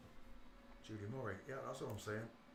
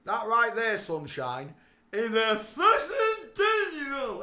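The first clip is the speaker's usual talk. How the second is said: excited shout; 11 semitones higher, 14 dB louder, much slower than usual. Acted. Gushing mock awe at photo.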